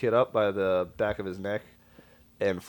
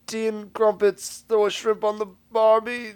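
mock British accent; 12 semitones higher, 5 dB louder than usual. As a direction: overdo a jokey British accent. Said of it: "like, a London accent".

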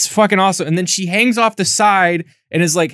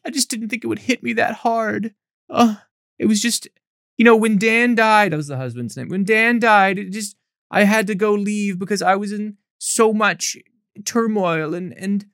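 mock self-pity; 5 semitones higher than usual. Mocking Hannah making Dan's death about herself, whiny. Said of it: "Hannah's like".

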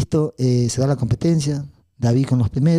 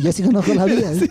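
laughing mock boast; 6 semitones higher, 3 dB louder than usual. Repeats David's boast, laughing.

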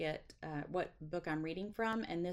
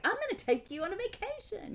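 mock excited husband; 13 semitones higher than usual. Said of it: "He's not like".

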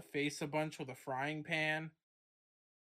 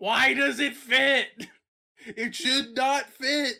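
incredulous shout; 7 semitones higher, 10 dB louder, slower than usual. Acted. Outraged at an absurd claim.

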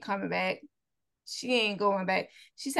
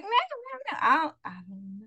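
high flustered mock voice; imitating Olivia; 8 semitones higher than usual. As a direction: play her nervous denial. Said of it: "Olivia's like".

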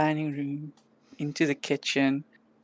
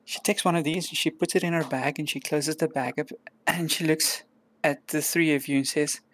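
ordinary delivery

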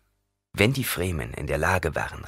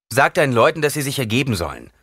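higher, louder order, then quick; commanding; 6 semitones higher, 5 dB louder, faster than usual. A narrator's reading. Paul orders Gurney to make his men surrender, commanding. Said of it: "befahl Paul".

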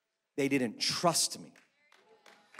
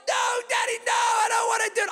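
put-on much higher, louder, fast child's shriek; shrieking little girl; 3 dB louder than usual. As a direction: wail no like a panicked little girl. Daughters refusing in shouting match.

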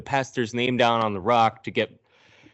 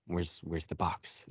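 hushed mock; 4 semitones lower, 12 dB quieter than usual. [name] voiced quietly.

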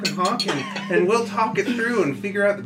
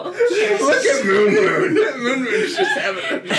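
high, slow laughing words; 10 semitones higher, 6 dB louder, slower than usual. Real, not acted. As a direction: giggle through the words. Laughing at Moon Moon.

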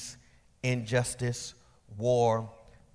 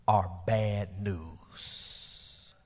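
slow grave drop; 5 semitones lower, 7 dB quieter, much slower than usual. Real, not acted. Grave statement.

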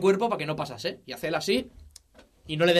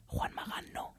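whispered hint; conspiratorial unvoiced whisper; secretive whisper; 14 dB quieter, slower than usual. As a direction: whisper the name slyly. Hinting a secret guest.

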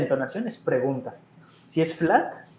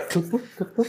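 quick "tuc" noises climbing high and soft; imitated crab noise; 7 semitones higher, 4 dB quieter than usual. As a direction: play at being a clicking crab. Imita el ruido de la jaiba. (ruidito agudo de jaiba)

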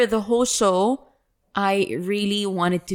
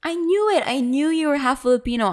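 triumphant; 7 semitones higher, faster than usual. Retold or imitated. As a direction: crow that you guessed right. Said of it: "I said".

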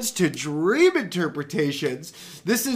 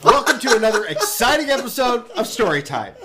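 grand entrance announcement; 3 semitones higher than usual. Acted. Host opens the show.

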